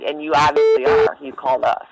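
ordinary talk

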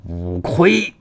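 drawn-out battle cry; commanding shout. A narrator's reading. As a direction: call out the spell with force. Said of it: "喝道".

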